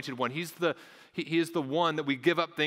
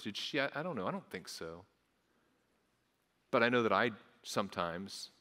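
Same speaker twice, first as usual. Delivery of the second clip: ordinary talk, nothing marked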